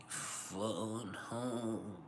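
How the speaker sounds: soft, drawn-out alien voice; hushed mock alien croon; alien impression. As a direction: lightly imitate a homesick movie alien, stretched out quietly. Quoting the film alien's famous line.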